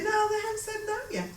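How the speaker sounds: high, laughing objection; mock protest. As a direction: resist the urging in an amused, high-pitched voice. Her laughing retort to Norma's urging.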